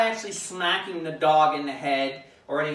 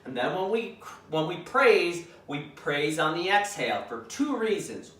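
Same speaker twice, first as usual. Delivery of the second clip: ordinary talk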